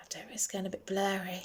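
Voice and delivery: soft unvoiced whisper to herself; a hushed, fast whisper about the blurry camera; whispered aside. Real, not acted. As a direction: breathe it as a quick aside. Muttered aside while camera blurs.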